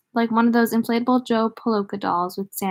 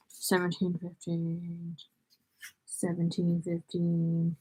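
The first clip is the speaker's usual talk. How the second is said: slow, soft and idle; drawn-out muttering; 3 semitones lower, 10 dB quieter, slower than usual. Real, not acted. Reader hunting for the right part, slowly muttering the number. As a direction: stretch the number out under your breath.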